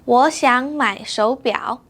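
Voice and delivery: 我想买手表 a bit slow; clear, measured tones; slow model. Shown as a demonstration. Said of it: "now it's your turn".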